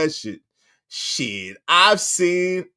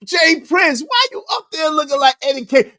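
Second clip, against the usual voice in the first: ordinary talk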